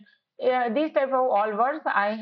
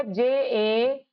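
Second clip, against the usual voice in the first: slow letter-by-letter; slow spelling; much slower than usual. Shown as a demonstration. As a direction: dictate each letter slowly. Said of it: "कैसे होगा". (धीरे-धीरे अक्षर)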